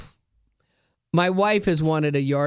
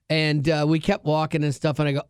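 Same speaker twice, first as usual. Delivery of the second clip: normal talking voice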